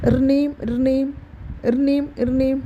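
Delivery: sing-song ringing sound, slow; phone ring imitation. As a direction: mimic a toy phone ringing. (Phone ബെല്ലിന്റെ ശബ്ദം അനുകരിച്ച്)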